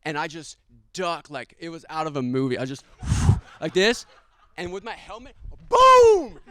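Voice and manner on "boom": boom! shouted very high and loud. Retold or imitated. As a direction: slam the word out like the impact. Helmet hits attacker.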